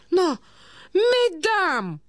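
high-pitched cry of protest; old prince crying out. A narrator's reading. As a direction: call out over the quarrelling ladies, high. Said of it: "закричал князь". (князь, высокий протестующий выкрик)

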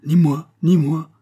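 high, loud echoing pledge; repeated by many. A narrator's reading. Soldiers in the ranks refusing to fire, loud and high. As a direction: call it out from the ranks. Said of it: "répétèrent cent voix".

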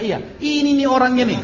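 high-pitched, eager pointing-out; retold cry of recognition, voice jumping up; excited identification. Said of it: "Kata mereka".

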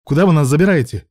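loud, high question; alarmed shout. A narrator's reading. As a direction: shout it in protest. Prisoner protests being taken away.

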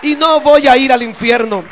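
loud, soaring sermon voice; fiery preaching. Acted. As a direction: preach it out to the whole church. Preacher at full force.

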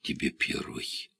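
slow, hushed breath of a confession; выдохнул. A narrator's reading. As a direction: breathe the words out softly. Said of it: "выдохнул Широков". (на выдохе, тихо)

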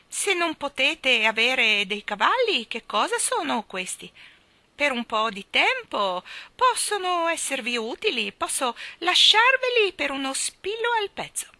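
put-on high, loud squawky old woman; eccentric old lady. Miss Calico boasting to the children, high and loud. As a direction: keep the shrill hen voice going.

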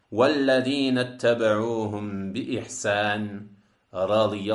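solemn chant settling gently downward; Quran recitation. Acted.

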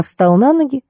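ordinary delivery

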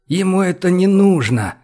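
high-pitched, pressing persuasion; soft but insistent. A narrator's reading. Boris reasons with Olga, gently insistent. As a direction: press the point without anger. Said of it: "произнёс Борис мягко, но настойчиво".

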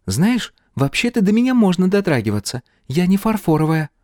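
put-on high-pitched girl's voice; high girlish character voice. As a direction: voice a chatty girl, pitched well up. Girl character addressing the hero.

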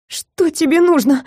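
high, trembling frightened whisper; terrified pleading hostage. Acted. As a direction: ask it shaking with fear. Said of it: "дрожащим голосом прошептала я".

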